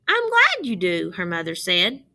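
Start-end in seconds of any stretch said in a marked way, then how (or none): 0.1-1.1 mother's voice. Acted. Mother replies warmly in story.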